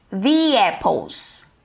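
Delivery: "the apples" slowly, "the" as "thee"; slow model of "the apples" with a long ee in "the"; THE before a vowel. Shown as a demonstration. English teacher demonstrating "the apples", slow "thee" before the vowel. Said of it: "vai ser pronunciado como".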